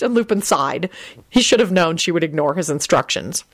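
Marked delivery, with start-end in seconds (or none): none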